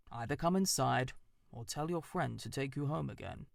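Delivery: a wise owl questioning from his hollow; stern old owl. Acted. Owl lectures the mouse.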